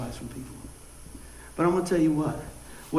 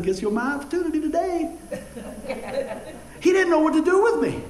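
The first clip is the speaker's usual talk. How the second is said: laughing; 10 semitones higher than usual. Real, not acted. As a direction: chuckle through the punchline. Laughing at his own retort.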